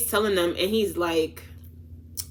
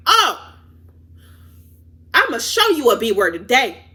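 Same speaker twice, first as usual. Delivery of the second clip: retold yelling; 3 semitones higher, 4 dB louder than usual. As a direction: raise your voice as you replay the argument. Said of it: "I'm screaming".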